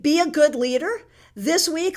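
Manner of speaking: usual speaking voice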